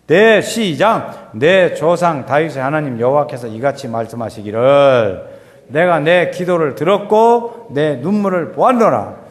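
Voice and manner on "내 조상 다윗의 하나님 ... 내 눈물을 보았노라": measured, slow, louder reading of the verse; scripture reading. Acted. Preacher reading the Bible passage to the congregation, solemn reading. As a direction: read the holy words with weight.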